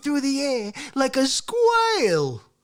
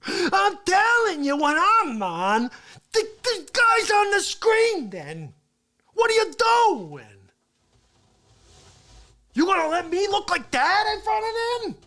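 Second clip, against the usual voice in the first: high, loud furious rant at his engineer; angry yelling; 9 semitones higher, 5 dB louder than usual. Acted. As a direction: chew him out in front of everyone.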